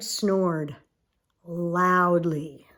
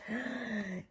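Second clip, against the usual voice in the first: soft gasp; 15 dB quieter than usual. Acted. Surprised gasp in story.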